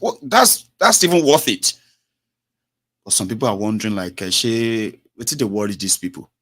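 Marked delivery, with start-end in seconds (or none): 0.0-1.7 high, louder wry aside; tossing off a dry ironic comment; mock-flippant quip; ironic remark. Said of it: "I was like".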